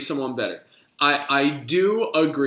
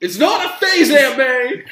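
put-on whiny teen shout; 11 semitones higher, 7 dB louder than usual. Mock emo teen outburst.

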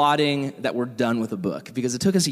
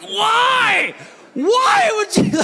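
much higher, loud squeaky mouse shout, drawn out; mouse's cry; 5 dB louder, much slower than usual. Retold or imitated. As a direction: cry out like a betrayed tiny mouse. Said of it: "and he's like".

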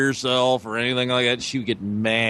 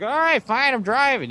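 high, huffy mock wife; 13 semitones higher than usual. Imitating his wife giving in, high and huffy. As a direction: squeak out a grudging yes.

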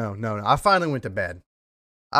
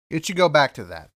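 usual speaking voice

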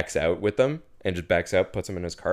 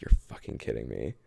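deadpan disbelief; 9 semitones lower, 6 dB quieter than usual. Retold or imitated. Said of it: "look at each other like".

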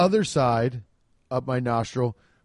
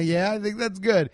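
acted chuckle into a higher-pitched answer; imitated laughing reply; 6 semitones higher than usual. Test lady answering the patient's question, mock laughing. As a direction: mimic her laughing as she agrees. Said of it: "And she started laughing".

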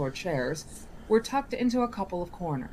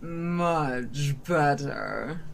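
slow smug approval; 2 semitones lower, much slower than usual. Acted. Genie satisfied.

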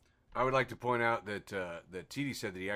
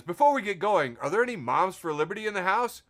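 louder, higher, like working a crowd; mock hype-man call; 6 semitones higher, 9 dB louder than usual. Host reads LeVar Burton meme to the audience, mock hype-man call. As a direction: call out to the room, loud and lifted.

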